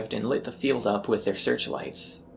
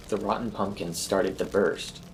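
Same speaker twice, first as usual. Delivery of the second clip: ordinary delivery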